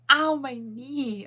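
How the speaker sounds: sharp loud ow, stretched slowly; sudden knee pain. Real, not acted. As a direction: yelp as the knee pops. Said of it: "I just popped my knee".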